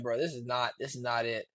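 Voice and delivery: low, quiet, fed-up mutter; understated irritated host, voice dropped; imitated irritation. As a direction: act out restrained annoyance, soft and low. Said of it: "you could tell he was irritated".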